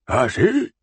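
high, mocking question; sneering citizen. Citizen mocking the girl, high and sarcastic. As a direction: scoff at her.

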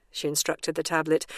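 plain reading voice

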